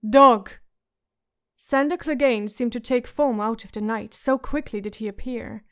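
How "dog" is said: sharp loud summons; called out. Acted. Prince calling his guard out of the night, loud sharp call. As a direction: bark the one word out loud across the hall. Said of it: "Joffrey called out".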